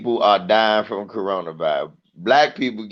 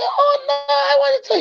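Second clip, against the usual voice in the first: much higher whiny lady voice; pleading lady. Retold or imitated. Comic mimicking a lady pleading with a toddler. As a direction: whine like a spoiled grown-up.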